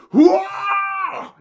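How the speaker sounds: comic high-pitched whoa; sudden yell. Retold or imitated. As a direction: blurt it out like a startled villain. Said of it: "out of nowhere he just goes like".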